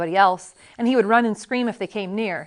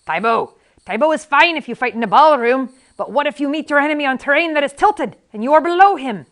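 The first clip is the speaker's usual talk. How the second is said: loud, raised, scornful challenge; scoffing; 6 semitones higher, 8 dB louder than usual. A narrator's reading. Crippled MacPherson lectures his pupil Inigo, loudly scoffing. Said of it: "MacPherson who scoffed at everything".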